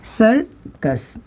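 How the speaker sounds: "Circus" very slowly as सर, pause, कस; split syllables. Shown as a demonstration. Teacher demonstrating syllables to students, "circus" slowly split in two. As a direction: pull "circus" apart into two separate beats. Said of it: "circus दो भागों में split किया".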